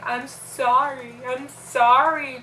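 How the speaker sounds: slow, pleading re-enacted wail, not fully cried; retold tearful apology. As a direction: imitate apologizing through tears, stretching the words. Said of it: "as I'm crying and screaming".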